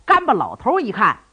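ordinary delivery, nothing marked

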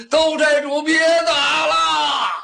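high call with a long held ending; pleading shout. A narrator's reading. Captives beg their chief to stop fighting, drawn-out high shout. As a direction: holler it out, stretching the last word. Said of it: "齐声这么一喊".

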